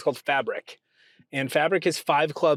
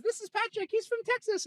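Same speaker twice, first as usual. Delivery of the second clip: very high, softer British voice; imitated high-pitched British girl; British girl's accent; 7 dB quieter than usual. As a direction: introduce someone in a squeaky put-on British accent. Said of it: "And she goes".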